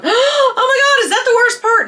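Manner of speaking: very high, mock-horrified kid voice. Vlogger imitating kids listening to the story, mock horror. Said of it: "kids out there are going".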